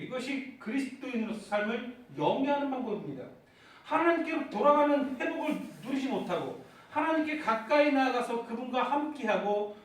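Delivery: usual speaking voice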